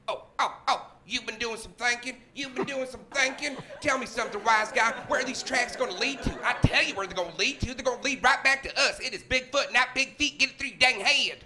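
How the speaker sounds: high, mocking backwoods partner; scornful hoaxer. Hoaxer tells off his partner in the woods, high scornful voice. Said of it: "what I imagine a conversation would be like".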